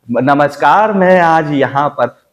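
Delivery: slow stretched newsreader greeting; drawn-out rhythm. Shown as a demonstration. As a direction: lean into each word slowly. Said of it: "rhythm जो है".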